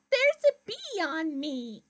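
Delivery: a fussy duck grumbling about the bee. Acted.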